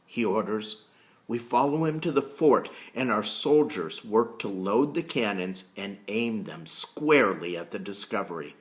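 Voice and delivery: plain reading voice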